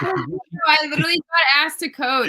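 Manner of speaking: loud protest; defensive. Real, not acted. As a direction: insist you were asked. Defending herself in argument.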